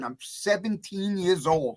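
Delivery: normal talking voice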